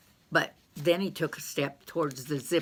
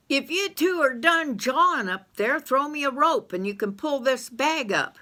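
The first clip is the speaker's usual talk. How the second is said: calling up; 8 semitones higher than usual. Acted. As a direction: call up a mine shaft. Calling up the shaft.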